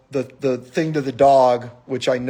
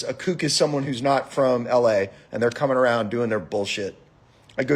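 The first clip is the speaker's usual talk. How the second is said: ordinary delivery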